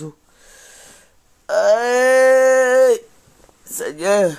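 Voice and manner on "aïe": high, loud cry of pain; hammed-up ouch; loud mock groan. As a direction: yelp as the old bones creak. Groaning getting up.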